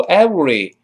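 "every" said wrongly as ewery. Shown as a demonstration. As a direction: blur the v of "every" into a w. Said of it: "不对，不是every". (错误示范：“every”读成ewery)

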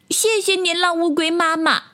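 put-on high-pitched childlike thanks; little tadpole. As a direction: thank them in a tiny high voice. Tadpoles thank mother turtle. (小蝌蚪稚嫩地高声道谢)

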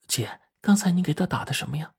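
quiet, curious little-sister voice; hushed question. A narrator's reading. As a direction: ask it secretively, keeping the voice down. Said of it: "小琴就悄声地问说".

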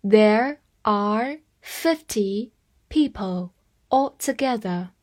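slow, careful sentence reading. Shown as a demonstration. Teacher reading slowly for students to repeat. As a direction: read it slowly so learners can follow. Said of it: "我慢慢来读".

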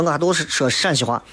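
ordinary delivery

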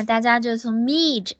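usual speaking voice

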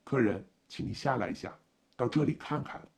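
low, loud voice of the driver; driver role. A narrator's reading. Driver inviting his passenger to come and look, low-voiced. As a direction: call the passenger out in a low, firm voice.

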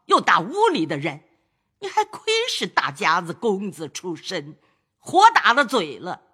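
high, sharp lecture from an elder; old lady scolding. Acted. Grandmother lecturing kneeling grandson, scolding. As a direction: keep berating him, then bargain. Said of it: "贾母又道".

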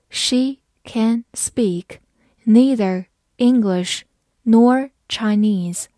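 very slow, each chunk separate; slow reading. Shown as a demonstration. Teacher reads slowly for learners, slow and chunked. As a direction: spell it out slowly in pieces. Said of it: "好，慢慢来读".

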